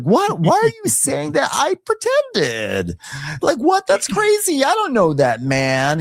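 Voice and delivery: very high, slow mock-shocked squeal; mocking Drew. Drew denying the horse story, shrill mock denial. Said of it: "Drew's like".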